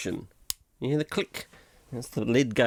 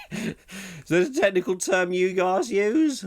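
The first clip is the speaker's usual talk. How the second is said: a lightly higher put-on voice; mock questioner voice; 7 semitones higher than usual. Host mocks his own jargon in a higher voice. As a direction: pipe up as someone else.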